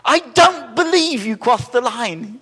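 high, loud, slow mock pharaoh; pharaoh's empty threat. Preacher mocks the pharaoh's empty threat to parents, high and loud. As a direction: drag out the outraged threat in a put-on voice. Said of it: "he goes".

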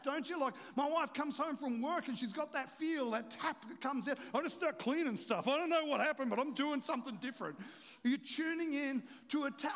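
high, loud, driving sermon delivery; full-force preaching. Real, not acted. Preacher urging congregation to get this, preaching at full force.

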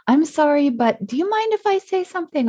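high, quick mock-timid apology; mock apologetic. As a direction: squeak it out apologetically. Mocking habitual apologizing.

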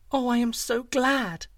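put-on a little girl gushing with relief; glad girl. A narrator's reading. Hugh Lin rejoices for the young man, high slow delight. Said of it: "said Hugh Lin".

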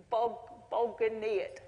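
slow, halting stab at Russian; haltingly attempted Russian word. Shown as a demonstration. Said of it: "my best attempt at Russian".

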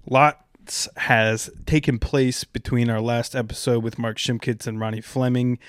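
usual speaking voice

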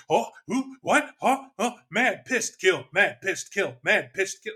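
startled high grunts settling into a chant; mock a bewildered player working up to rage in single words; choppy one-word outbursts, lightly acted; imitating a confused player. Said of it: "the player is supposed to be".

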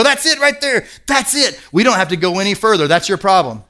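excited, fast-talking counselor; excited realisation. Retold or imitated. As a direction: pounce on the answer with glee. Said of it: "And I'd go".

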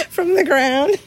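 words break into a high, loud laugh. Real, not acted. Owner recalling her dog's scavenging, laughing. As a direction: laugh out the last words, amused. Laughing about dog picking scraps.

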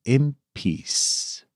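upbeat host announcement over music; announcer intro. Acted. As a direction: introduce the show like a radio host.

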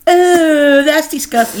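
high, loud "oh" of disgust; real grossed-out outburst. Real, not acted. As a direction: recoil in disgust, pitch up. Reacting to a gross remark.

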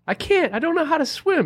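put-on high childlike whine; little kid voice. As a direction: squeak it like a small boy. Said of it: "And he goes".

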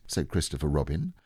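usual speaking voice

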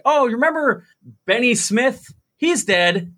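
high, lilting Allentown grandma; high-pitched Allentown lilt stepping down; Allentown sing-song. Retold or imitated. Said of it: "that sing-song way of speaking".

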